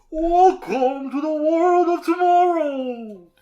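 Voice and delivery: slow, high, booming showman voice; grand announcer. Acted. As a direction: proclaim it like a world's fair host. Grand showman intro line.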